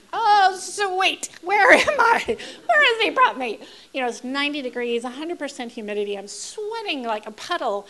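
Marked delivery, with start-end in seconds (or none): 0.1-3.6 much higher and louder sarcastic dismay; hammed-up horror at the bucket; mock shock. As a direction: shriek it in comic disbelief. Shocked by airport toilet.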